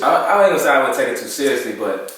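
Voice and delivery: normal talking voice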